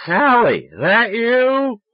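put-on high, loud drunken shout; drunken screaming. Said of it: "stop screaming".

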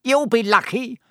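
high, taunting poltergeist voice; Peeves, cackling. A narrator's reading. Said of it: "said a cackling voice".

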